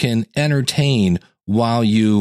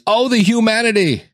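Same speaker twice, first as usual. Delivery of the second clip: imitating Hindenburg announcer; 12 semitones higher than usual. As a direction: wail it like the 1937 radio reporter. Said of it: "If I said the phrase".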